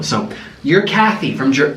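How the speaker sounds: usual speaking voice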